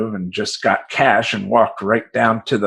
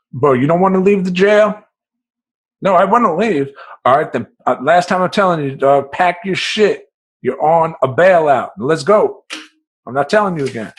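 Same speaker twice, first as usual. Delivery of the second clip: loud, high, fed-up guard; guard warning the inmate a last time; impatient guard; 7 semitones higher, 4 dB louder than usual. Retold or imitated. Guard losing patience.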